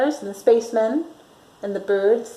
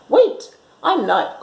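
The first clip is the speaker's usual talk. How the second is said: nervous knight; 7 semitones higher, 4 dB louder, slower than usual. Acted. Knight stalling the dragon.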